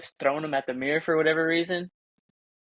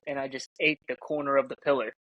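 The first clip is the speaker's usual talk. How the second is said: ordinary talk, nothing marked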